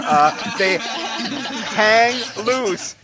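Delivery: slow and laughing, stretched; slow drawn-out laugh. Acted. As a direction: drag it out through laughter. Comic drawn-out joke line.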